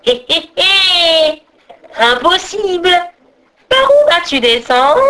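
high, gloating witch cackle; gleeful ogress. A narrator's reading. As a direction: cackle and taunt with wicked glee. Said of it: "Elle grinça des dents et s'amusa".

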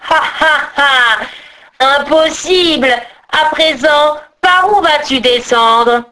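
wicked witch laugh, then gloating taunt; evil laugh. A narrator's reading. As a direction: cackle and taunt like a nasty hag. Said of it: "elle éclata d'un rire mauvais".

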